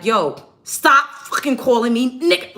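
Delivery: furious retold yell at the boss; cussing him out. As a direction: recreate telling off the manager at full force. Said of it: "like, like that".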